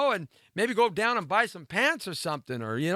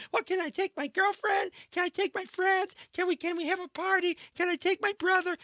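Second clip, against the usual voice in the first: high-pitched mock girlfriend voice; whiny pestering girl; 13 semitones higher, 3 dB quieter than usual. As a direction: nag in a high, put-on girly voice. Voicing the girl's pestering questions.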